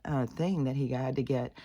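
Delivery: normal talking voice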